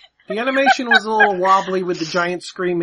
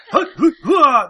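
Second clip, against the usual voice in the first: loud, higher rhythmic grunts; mock grunting; 6 semitones higher, 7 dB louder than usual. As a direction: grunt it out like a hulking hero. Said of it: "I do".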